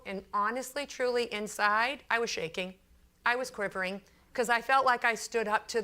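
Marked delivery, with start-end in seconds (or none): none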